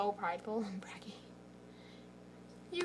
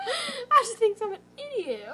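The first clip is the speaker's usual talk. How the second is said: laughing; 11 semitones higher, 6 dB louder than usual. Real, not acted. Laughing hard after a joke.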